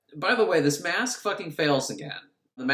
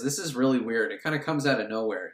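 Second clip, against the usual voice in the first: ordinary delivery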